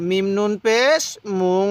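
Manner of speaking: slow spelling, মুং held as a nasal hum; teacher's prolonged nasal মুং; ghunnah demo. Shown as a demonstration. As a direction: let মুং ring through the nose. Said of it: "নাকের বাসি দ্বারা গুন্নাহ করুন".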